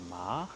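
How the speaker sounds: MA starting low and sweeping up; rising second tone. Shown as a demonstration. Teacher asking what French sound resembles it, rising tone. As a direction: let Ma climb like a question. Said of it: "c'est quelque chose qui va monter comme ça".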